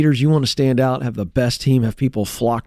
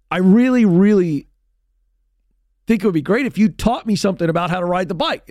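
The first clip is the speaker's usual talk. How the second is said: put-on higher, urgent plea from a kid; pleading kid; 7 semitones higher than usual. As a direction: beg your dad earnestly, pitched up.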